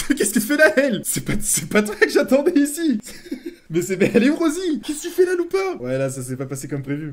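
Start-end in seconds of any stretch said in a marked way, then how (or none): 0.1-3.0 high, loud, laughing outcry; real laughing shock. Real, not acted. Wrong character appears at proposal. 3.7-5.8 very high, loud questioning; real shocked outcry. Real, not acted. Wrong character appears at proposal.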